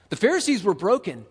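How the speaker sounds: ordinary talk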